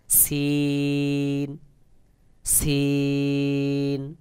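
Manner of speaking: সিন thin with hissing air, held very long; thin, airy. Shown as a demonstration. Teacher models the Arabic letter, thin drawn-out সিন. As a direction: stretch a thin সিন with outward breath. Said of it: "আওয়াজটা পাতলা মতো করে হবে".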